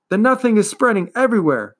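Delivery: put-on boy warning the turtle, urgent. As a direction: insist it is spreading. Boy continues crying out.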